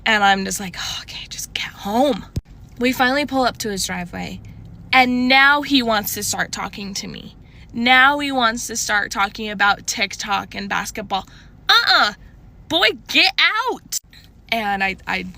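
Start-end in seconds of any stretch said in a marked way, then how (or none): 0.8-2.2 hushed, fed-up inner voice; a whispered "just get me home"; whispered aside. Retold or imitated. Awkward silent drive, inner thought. 11.7-14.0 sassy dismissal. Real, not acted. Fed up as he starts talking.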